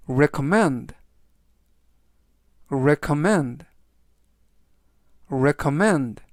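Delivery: recommend repeated clearly, falling at the end; model pronunciation. Shown as a demonstration. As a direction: say recommend slowly with a fall, for the learner to copy. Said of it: "라고 발음하시면 되겠고요".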